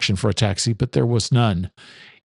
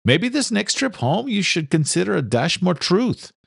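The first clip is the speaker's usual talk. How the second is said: put-on higher young man, fondly teasing; higher voice, affectionate mockery; young son teasing; 8 semitones higher than usual. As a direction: son ribbing his father's tall tales. Son's voice in story.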